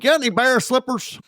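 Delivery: mock customer.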